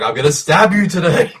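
high, loud, laughing mock threat.

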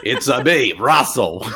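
mock Greek accent. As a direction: ham up a silly Greek accent. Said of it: "I wanna hear your Greek accent".

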